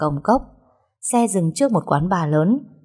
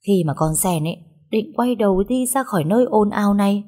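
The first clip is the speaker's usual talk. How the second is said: ordinary delivery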